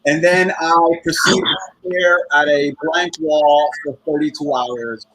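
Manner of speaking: ordinary delivery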